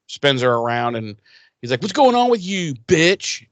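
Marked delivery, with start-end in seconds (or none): 1.8-3.5 tag. Retold or imitated. Acting out Riley confronting Buffy.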